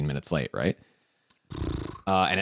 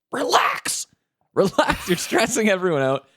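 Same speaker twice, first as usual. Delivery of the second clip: mock calming; 10 semitones higher than usual. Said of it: "I'm like".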